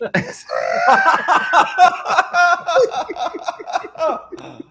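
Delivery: high, sustained cackling laugh; long laugh. Real, not acted. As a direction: burst out laughing at the punchline. Laughter at the punchline.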